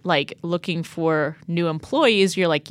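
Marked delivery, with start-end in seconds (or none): none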